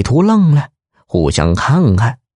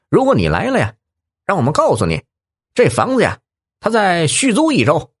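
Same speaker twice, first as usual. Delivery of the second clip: raised voice; 7 semitones higher than usual. Acted. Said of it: "于是他提高了嗓门说".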